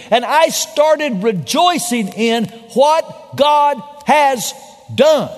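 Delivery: high, loud, slowed preaching at full force. Real, not acted. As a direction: preach it out, dragging the key words. Preacher builds to high point.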